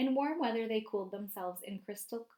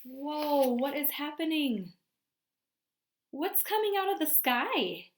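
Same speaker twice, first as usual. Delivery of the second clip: surprised; 5 semitones higher, 5 dB louder than usual. Pretend. Reacting to pictures with kids.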